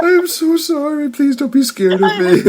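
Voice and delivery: high, sweet mock-sorry voice; mock apology. As the villain, apologising sweetly to scared puppies. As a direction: coo a pretend apology.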